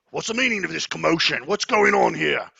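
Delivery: loud, high, demanding guard voice; stern guard captain. Acted. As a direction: bark it like an old guard demanding order.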